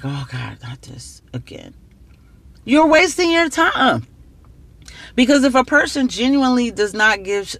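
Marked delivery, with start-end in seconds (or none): 0.0-1.7 weary, annoyed and hushed; exasperated sigh. Retold or imitated. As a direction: groan it like an annoyed partner. Imitating annoyed partner, sighing.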